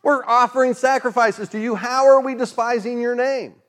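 high, indignant mock protest; protesting Israelites. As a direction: play the baffled worshipper, pitched high. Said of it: "they're like".

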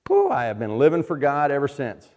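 loud, rising mock-zealous boast; mock triumphant testimony. Pretend convert to the church, mock triumphant. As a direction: proclaim it like an ideal convert, hammed up. Acting an idealized testimony he disowns.

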